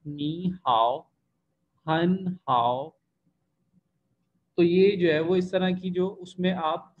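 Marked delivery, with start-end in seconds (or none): 0.1-2.9 slow नी हाओ, नी rising like second tone; drawn-out Mandarin हाओ with a dipping tone; third tone sandhi demo. Shown as a demonstration. Teacher models tone sandhi examples, slow. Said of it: "उसकी हमने मिसालें".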